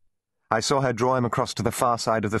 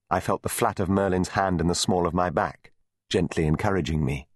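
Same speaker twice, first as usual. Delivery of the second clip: ordinary delivery, nothing marked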